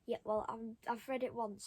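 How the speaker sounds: usual speaking voice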